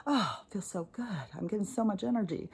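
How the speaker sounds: soft, hushed mock bliss; mock blissful savouring. Energy feeders savouring the chaos, mock blissful. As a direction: savour it softly, mock-contented. Voices people feeding off energy.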